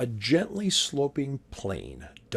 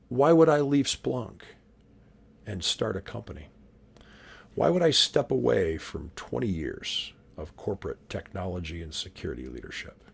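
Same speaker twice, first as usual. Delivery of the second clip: ordinary talk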